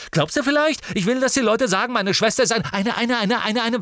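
high, loud, fast tirade that sticks on a word; furious stammering rant. Acted. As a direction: rage so hard the word won't come. Ron rants, stuck for a word.

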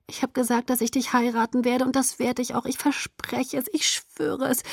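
soft, high, wheedling voice; hushed, pleading persuasion; coaxing. A narrator's reading. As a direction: coax sweetly, dropping towards a breathy whisper. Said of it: "meine Stimme wurde schmeichelnd".

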